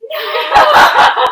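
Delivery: very high, long, loud wail; hammed-up cry of refusal, half laughing; retold horrified cry. Reacting to delivering her own baby, mock horrified wail. Acted horrified reply to the news.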